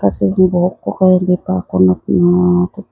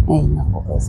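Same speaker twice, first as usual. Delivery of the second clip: ordinary delivery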